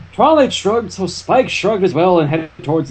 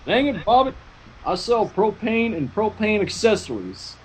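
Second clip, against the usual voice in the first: lightly voiced Hank Hill; 4 semitones higher, 6 dB quieter than usual.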